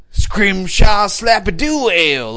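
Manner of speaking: slow, high, grandly drawn-out; mock fancy name. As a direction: announce it like something ridiculous. Mocking silly beer names.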